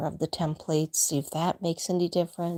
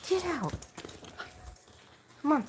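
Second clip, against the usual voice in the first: shooing; 10 semitones higher, faster than usual. Real, not acted. Shooing dog out the door.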